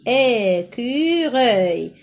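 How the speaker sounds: "Écureuil" slow and stretched, é-cu-reuil; syllable by syllable. Shown as a demonstration. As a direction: draw out all three syllables of "Écureuil". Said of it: "Et troisième sy".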